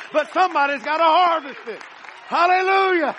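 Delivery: loud, climbing sermon cry to the church; full-force preaching. Acted. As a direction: preach it out loud, building to Hallelujah.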